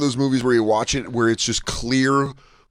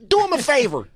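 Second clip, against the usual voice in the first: high mobster voice; mock gangster plea, pitched way up; movie tough-guy impression; 13 semitones higher than usual. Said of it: "even when he goes".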